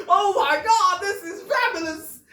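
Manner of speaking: high-pitched gleeful squeal; mock delight. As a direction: gush as if handed a golden chance. Acts homeless man delighted at his chance.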